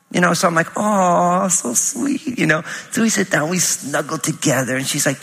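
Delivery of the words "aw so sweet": touched aw. Retold or imitated. Dad melting at daughter's request.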